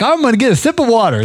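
high, quick host impression; Brian. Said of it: "but he's always like".

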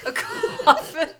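high, slow, laughing "a coffin?"; stunned and cracking up; laughing disbelief. Real, not acted.

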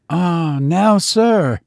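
high, joyful cry; overjoyed cry. A narrator's reading. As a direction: cry out with relief. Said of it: "cried the overjoyed Biggs".

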